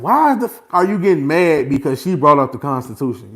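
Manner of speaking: loud, high indignant shout; indignant outburst. Retold or imitated. Reacting to the judge in court, a loud indignant outburst. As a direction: blurt out your outrage. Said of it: "I'm like".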